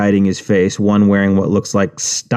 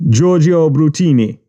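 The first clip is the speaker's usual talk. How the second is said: put-on proud boss in Jamaican accent; higher Jamaican voice, showing off; 7 semitones higher than usual.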